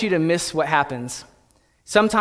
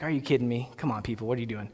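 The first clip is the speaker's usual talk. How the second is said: grumbling; 8 semitones lower, 11 dB quieter, much faster than usual. Retold or imitated. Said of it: "I'd be grumbling".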